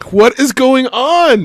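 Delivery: high, loud, slow "what is going on"; exasperated disbelief. Retold or imitated. Teacher retelling how he reacted to the student. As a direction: stretch it out in stunned disbelief. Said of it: "And I'm just like".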